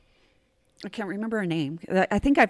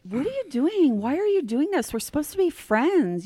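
higher, insistent acted questioning; acted-out hurt appeal in a higher voice; retold pleading confrontation; 7 semitones higher than usual. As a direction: plead with a friend to explain, pitched up. Retold confronting her friend.

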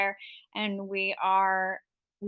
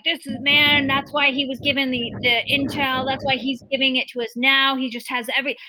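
fast, louder, higher rush of hype; mock hyped rant; 5 semitones higher, 6 dB louder, faster than usual. Retelling Alex Jones's broadcast, a fast, louder, higher mock rant. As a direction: rattle it off like a hyped-up host. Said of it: "and then Alex was like".

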